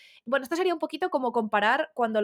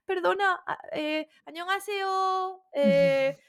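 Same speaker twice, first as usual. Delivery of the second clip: high, hesitant mock plea; pleading brand; 6 semitones higher than usual. Brand begging boycotters to spare it, sheepish and pleading. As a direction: stammer an apologetic, higher-pitched plea.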